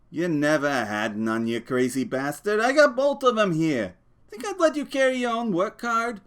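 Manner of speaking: scornful. Acted. George scornful, has cards.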